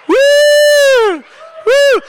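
very high, sustained "woo"; long high whoop. Real, not acted. Preacher stirring up the church, long piercing whoop.

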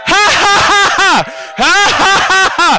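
high, pumping "ha ha ha"; forced loud laugh. Shown as a demonstration. Preacher stirring up the crowd, high forced laughter. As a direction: laugh big to stir them up. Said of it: "need to stir it up".

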